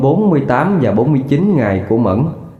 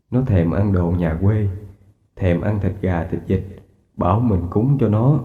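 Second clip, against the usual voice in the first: 1 grieving father; 5 semitones lower, 4 dB quieter than usual. Acted. Grieving father tells wife of dream.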